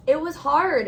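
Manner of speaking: normal talking voice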